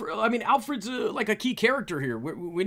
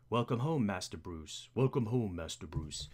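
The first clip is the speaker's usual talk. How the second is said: low butler voice, dropping softer on the name; Alfred; 10 semitones lower, 7 dB quieter than usual. Acted. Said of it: "we need a voice for Alfred".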